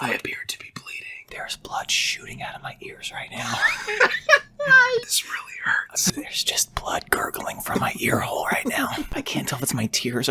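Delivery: breathy mock ASMR whisper. Friends joking about ASMR, mock whisper.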